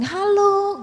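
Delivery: high, cheery acted hello; lifted, sing-out hello; friendly greeting. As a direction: call out a welcome to an arriving friend.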